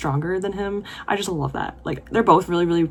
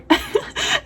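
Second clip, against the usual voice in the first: high squeaky delighted giggle; real burst of giggling; 3 dB louder than usual. Real, not acted. Laugh at cute scene.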